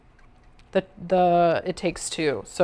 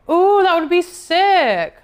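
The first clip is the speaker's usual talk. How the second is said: excited; 10 semitones higher, 6 dB louder, slower than usual. Real, not acted. Reacting to viewer's offer.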